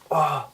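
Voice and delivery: high, startled mock "oh!"; startled cry. Storyteller voicing the hero seeing burnt underwear, mock startled cry. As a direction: yelp it in mock shock.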